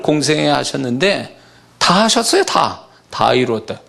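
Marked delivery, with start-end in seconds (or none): none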